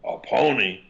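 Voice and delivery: ordinary talk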